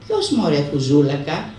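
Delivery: usual speaking voice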